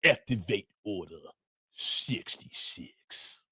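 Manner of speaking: low, slow menacing whisper of a command; deep evil emperor delivery; Emperor Palpatine voice. Shown as a demonstration. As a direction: hiss the order like a sinister villain. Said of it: "you gotta say it like my man".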